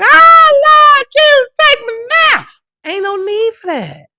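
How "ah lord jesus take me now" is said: much higher and louder wail; hammed-up mourner crying out; mock wailing mourner. Mocking a grieving relative at a funeral, loud wail. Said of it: "ain't no need to be like".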